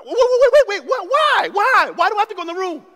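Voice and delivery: high, loud frantic pleading; panicked child protest. Retold or imitated. Young boy to his mom, loud frantic pleading. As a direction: blurt it like a scared kid caught out.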